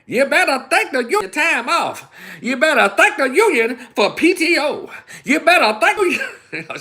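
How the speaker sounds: high, loud, laughing mock Kamala shouting about the union; hammed-up rally shout, way up high; loud mock stump-speech holler; loud mock preacher. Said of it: "And she gets all loud".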